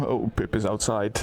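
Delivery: ordinary talk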